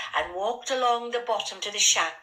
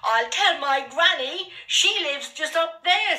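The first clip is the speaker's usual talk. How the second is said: put-on high, louder small-boy protest; raised, childlike voice standing up to bullies; boy's threat; 7 semitones higher, 5 dB louder than usual. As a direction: pipe up defiantly with your last threat.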